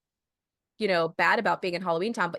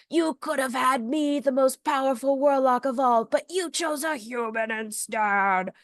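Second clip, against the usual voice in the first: theatrical warlock reproach, pitched up; hammed-up villain voice, higher than natural; Calabar; 4 semitones higher than usual. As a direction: declaim it grandly, slowing into the ending. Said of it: "then he says".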